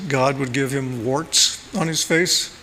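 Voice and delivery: ordinary talk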